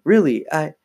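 mildly stammering denial; stammered. A narrator's reading. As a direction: break off mid-protest. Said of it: "Chris stammered".

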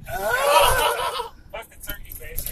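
laughing it out, high and slow; cracking up through the words. Real, not acted. Laughing retelling of a joke.

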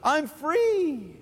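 high, slow mock boast of the carefree; imitating a smug believer. Preacher mimics a smug believer, high slow mock boast.

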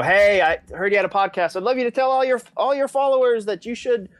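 high, chirpy mock pitch voice; pushy stranger. Mimicking a pushy stranger pitching a podcast host, high and chirpy. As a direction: imitate an overeager stranger asking favors. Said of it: "And they'll go".

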